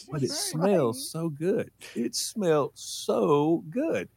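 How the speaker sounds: put-on slow, drawn-out, high kid's protest; child pleading. Kid defending gas sniffing to mom, pleading. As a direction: savor each word like an entranced child.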